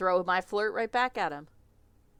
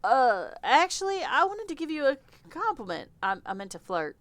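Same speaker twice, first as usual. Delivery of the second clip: lightly Southern, embarrassed and halting; Southern accent; 7 semitones higher, 6 dB louder than usual. Acted. Victor admitting he meant to flirt, light Southern accent. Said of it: "I don't know why he's Southern".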